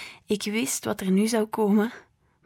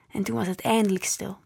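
ordinary delivery

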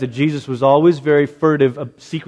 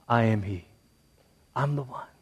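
much softer, lower, tender reveal; soft echo; 4 semitones lower, 11 dB quieter than usual. Acted. Preacher echoing Jesus's words softly to the congregation. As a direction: let it land gently and intimately.

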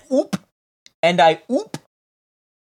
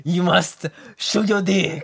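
slower, creaky mock accent; gremlin-like mock French; 3 semitones higher than usual. Teasing a friend with a gremlin-like French voice. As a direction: squeeze it out like a gremlin. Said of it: "like a gremlin".

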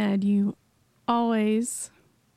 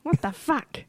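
Jersey swear impression; 4 semitones higher, slower than usual. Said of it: "It's like".

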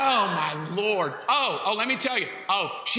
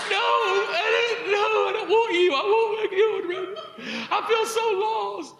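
mock distraught wife; 10 semitones higher than usual. Husband imitating wife refusing him for the kids. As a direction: overplay her heartbreak, laughing. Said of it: "I'm like".

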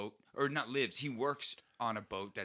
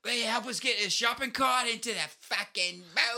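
much higher, louder Aussie shout; mock Australian; 12 semitones higher, 5 dB louder than usual. Drunk Australian demands help with the shopping cart, loud mock Aussie. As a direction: bark it like a drunk Aussie sailor. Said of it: "I got Australian".

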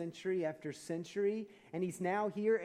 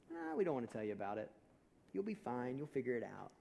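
dismissive mock shrug; 4 semitones lower, 3 dB quieter than usual. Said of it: "and we think".

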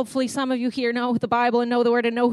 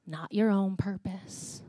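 whisper; 3 semitones lower, 5 dB quieter, slower than usual. Real, not acted. Sermon point, contrasting own purpose.

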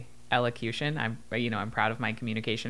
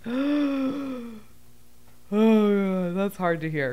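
shocked gasp; 7 semitones higher, much slower than usual. Retold or imitated. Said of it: "And it's like".

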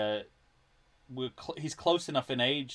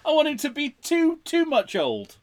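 excited high voice; 13 semitones higher, 6 dB louder than usual. Real, not acted. Excited about old Indy.

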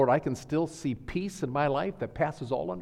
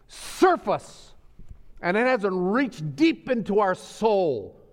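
high, loud pulpit cry with stretched key words; raised sermon voice, hammering the big words; impassioned preaching; 9 semitones higher, 7 dB louder than usual. Real, not acted. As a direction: preach it at full force, pleading urgently with the congregation. Preaches at full force.

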